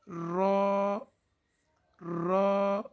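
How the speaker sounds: रा with the tongue tip tapping the palate; Arabic ra. Shown as a demonstration. Teacher demonstrating ra to viewers, clear tap. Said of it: "रा ज़बान की नोक और मुकाबिल के तालु".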